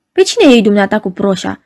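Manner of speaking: ordinary delivery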